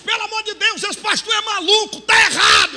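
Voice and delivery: protesto agudo e alto; mãe indignada. Retold or imitated. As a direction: grita indignada com o pastor. Mãe protesta contra o pastor. (mãe protestando aos gritos)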